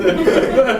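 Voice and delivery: bright rising laugh; quick amused laugh; short real laugh. Real, not acted. Laughs before the [name] story.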